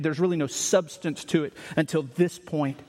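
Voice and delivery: ordinary talk, nothing marked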